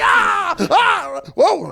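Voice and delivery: high-pitched punching cries; hammed-up martial-arts "ah, whoa"; mock karate yells. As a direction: yell with each pretend punch. Acts out excited karate punching.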